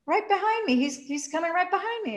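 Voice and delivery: a hint of Susan, cheerful. Susan voiced.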